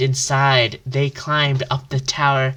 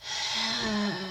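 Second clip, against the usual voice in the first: weary sigh; 7 semitones higher, 10 dB quieter than usual. Acted. Hero with broken horn.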